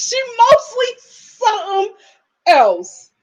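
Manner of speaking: high, drawn-out "mostly something", then "else" dropped after a pause; emphatic insistence. Real, not acted. Rejecting the claim she is mostly Irish, emphatically insistent. Insists, repeating the line for effect.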